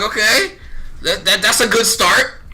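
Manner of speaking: high, slow, excited with a laugh. Retold or imitated. Pleased with the boss's praise, high and excited. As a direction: grin through it. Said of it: "I'm like".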